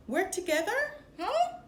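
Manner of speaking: stunned he'd suggest teaming up; baffled surprise. Acted. Nick caught off guard by Finnick's offer, startled disbelief.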